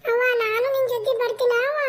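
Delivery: put-on a little girl asking to tag along; child voice. Daughter asks her mother to take her to work, light child voice.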